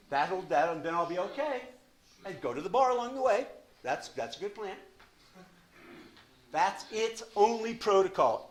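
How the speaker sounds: high, loud, driving sermon; fired-up preaching. Real, not acted. A preacher warning the room about the exit strategy, shouting high. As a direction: hammer the point like a preacher.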